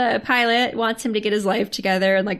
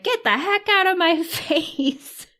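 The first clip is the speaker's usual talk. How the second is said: drawn-out, high-pitched mock outrage; grumpy cat retort; 10 semitones higher, slower than usual. Cat's voice rebuffing the hugging owner, mock outrage. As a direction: play an indignant cat, slowly, breaking into a laugh. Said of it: "And the voice is like".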